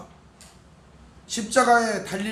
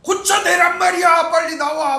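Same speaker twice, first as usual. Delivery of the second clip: loud, high yelling demand; angry demanding shout; 10 semitones higher, 8 dB louder than usual. Retold or imitated. Frantic parent yelling at the healer, angry shout. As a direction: bellow it like a furious order. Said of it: "막 이러면서 소리를 질러야".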